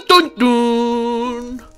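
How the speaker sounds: ordinary delivery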